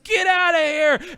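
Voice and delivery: high, loud, drawn-out order; frustrated yell. Retold or imitated. Dad ordering painted son out of the closet, frustrated yell. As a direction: yell it at the child, acted exasperation. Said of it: "I was so frustrated".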